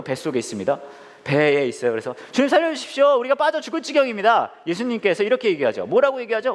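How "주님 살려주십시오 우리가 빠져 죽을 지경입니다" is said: high-pitched acted cry for help; desperate plea. Disciples waking Jesus in the storm, acted panic. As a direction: plead urgently, pitched well up.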